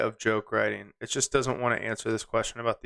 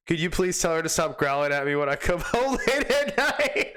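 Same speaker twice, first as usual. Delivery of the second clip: higher, cracking up towards the end; laughing; 4 semitones higher than usual. Real, not acted. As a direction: lose it while reading the punchline. Cracks up at the punchline.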